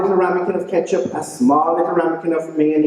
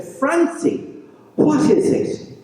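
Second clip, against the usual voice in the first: high, breathy worried question; high worried wife; 12 semitones higher, slower than usual. A narrator's reading. Betty asks her husband, high and worried. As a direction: ask up high and slow.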